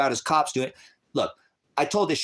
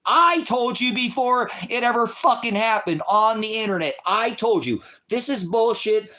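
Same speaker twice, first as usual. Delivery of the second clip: raised, higher pitched shout of told-you-so; heated ranting; 7 semitones higher than usual. Real, not acted. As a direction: blast it out like a vindicated prophet. Ranting that he predicted it.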